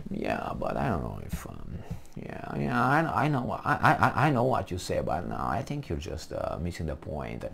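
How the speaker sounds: a soft, mumbling mock O'Reilly hedge; imitating O'Reilly. Mocking how the host dodged guests, soft and mumbled. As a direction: lightly mimic him dodging the point. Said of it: "He was talking like that all the time".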